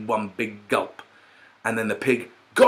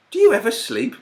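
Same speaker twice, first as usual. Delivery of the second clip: incredulous pig; 14 semitones higher than usual. Acted. Pig baffled at early time.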